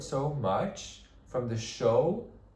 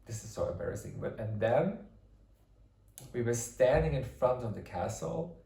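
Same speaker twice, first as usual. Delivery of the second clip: usual speaking voice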